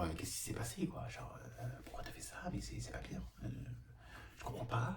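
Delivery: gossipy whisper. Acted.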